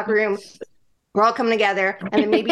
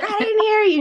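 playful chef yell, pitched much higher; mock Ramsay demand; 11 semitones higher than usual. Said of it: "Chef Ramsay in my head".

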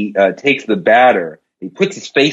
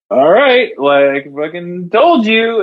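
cocky gambler; 6 semitones higher, slower than usual. Acted.

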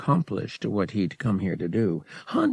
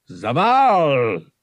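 a louder alien call to greet his overlord; hailing call; 4 semitones higher, 5 dB louder than usual. A narrator's reading. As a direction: call out respectfully across the mud. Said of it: "Teroenza hailed his Hutt overlord".

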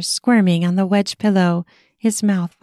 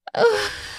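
blissful sigh; 14 semitones higher than usual. Acted.

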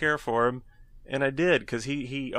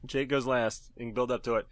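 ordinary delivery

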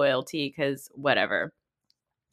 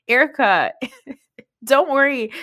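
sarcastic reassurance; 8 semitones higher, 6 dB louder than usual. Real, not acted. Mock reassurance to Erica.

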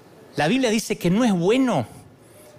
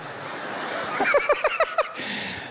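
airy giggle, very high; high breathless laugh; 4 dB quieter than usual. Real, not acted. Preacher cracking up at his own joke, high airy laugh. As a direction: burst out in a silent laugh.

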